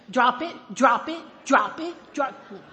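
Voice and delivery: loud repeated command drop it; yelling at dog. Retold or imitated. Owner yelling at her dog to drop it, loud. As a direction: bark it at the dog chasing him.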